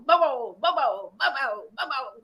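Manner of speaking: loud barking, fading as it goes; dog barking. A narrator's reading. Dog chases cats down the hill, loud fading barks. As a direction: woof like a chasing dog, then ease off. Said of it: "all the while barking".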